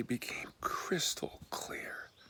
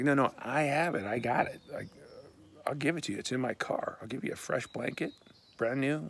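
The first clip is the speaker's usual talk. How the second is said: higher, insistent offer; eager insistence; 4 semitones higher, 5 dB louder than usual. Retold or imitated. Driver urging a man to take a fresh blanket, insistent. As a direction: press the offer, pitched up.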